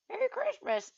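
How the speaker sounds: very high acted greeting; exaggerated sing-out; cheery greeter. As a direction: pipe it up brightly in falsetto. Acting out a greeting.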